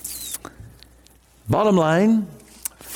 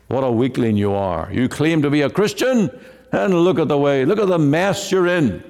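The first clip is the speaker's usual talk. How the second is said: devil accusing; 6 semitones higher than usual. Acted. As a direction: taunt like the devil sneering at a weakling. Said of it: "The devil, of course, is the accuser, and he says".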